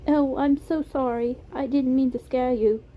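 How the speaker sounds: put-on apologetic British accent, lifted pitch; Beam, British accent. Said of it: "It was a soft British accent".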